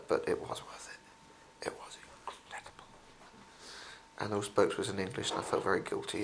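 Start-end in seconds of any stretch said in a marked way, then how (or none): 0.1-2.8 soft breathy whisper, barely voiced. Real, not acted. Whispering to the camera about a wonderful meal. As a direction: hush it right down, almost no voice. Quietly recapping the meal at night.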